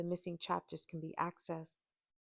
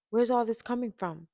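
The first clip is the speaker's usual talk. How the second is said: raised, louder voice of bewilderment; confused question; 5 semitones higher, 8 dB louder than usual. A narrator's reading. Confused man asking his angry ex, louder bewildered question. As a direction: demand an explanation, baffled. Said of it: "He looked confused as he asked".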